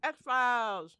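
talking while eating; words round a bite of food; mouth full. Real, not acted. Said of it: "Sorry, I'm eating".

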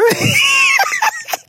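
very high squealing laughter; scandalised laugh. Real, not acted. Host reads that they slept together, squealing with laughter. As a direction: shriek with laughter at the confession.

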